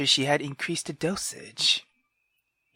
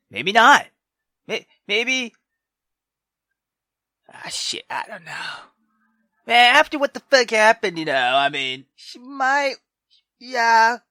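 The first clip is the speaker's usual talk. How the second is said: loud, high guessing; excited speculating; 9 semitones higher, 7 dB louder than usual. Real, not acted. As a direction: wind yourself up over the plot. Excited speculation about the plot.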